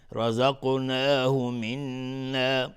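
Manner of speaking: slow loud chant, منا with a held humming double n; ghunnah on nun. Shown as a demonstration. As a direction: hold the nasal n in منا for two beats.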